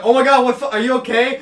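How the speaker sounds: mock alarm. Storyteller voicing guys checking on him after crash, mock alarm. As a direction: imitate someone rushing over in alarm.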